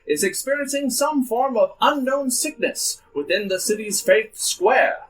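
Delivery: put-on grand old ballroom announcer; old-timey announcer. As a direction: boom it like vintage radio host. GM continues the news broadcast.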